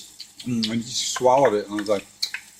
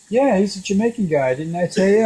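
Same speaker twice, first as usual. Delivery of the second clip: mom voice; 8 semitones higher than usual. Retold or imitated. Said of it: "She goes".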